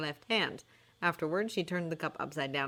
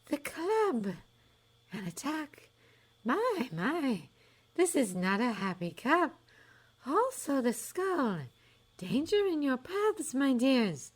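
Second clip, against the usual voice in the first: high, slow, dreamy prophetess; a high airy fortune-teller sizing up a doubter; misty seer voice; 9 semitones higher, slower than usual. A narrator's reading. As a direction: float it like a mystic seer. Trelawney reads doom.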